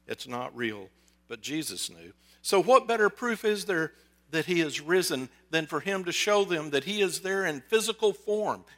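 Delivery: ordinary delivery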